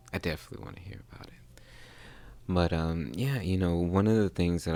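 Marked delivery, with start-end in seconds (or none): 0.1-1.6 fast hushed aside; whispered. Real, not acted. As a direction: whisper it quickly. Quick aside to listeners.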